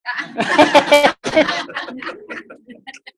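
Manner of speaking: hearty laugh at his own joke; laughing at the punchline; preacher's laugh. Real, not acted. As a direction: burst out laughing.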